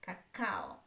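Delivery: ka-KA-o, brief first syllable in "cacao"; all on stressed syllable. Shown as a demonstration. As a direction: put all the weight on the second "a" of "cacao". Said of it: "All of my attention is on the stress syllable".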